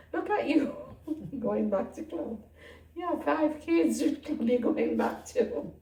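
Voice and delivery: high, loud teasing rebuke with a laugh; playful chiding, pitch jumping up; amused mock scolding. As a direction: imitate an incredulous telling-off, laughing through it. Holy Spirit's teasing rebuke, retold.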